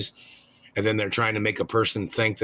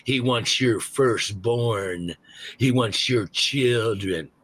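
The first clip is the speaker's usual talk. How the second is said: mocking quote; much slower than usual. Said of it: "those motherfuckers that try to say".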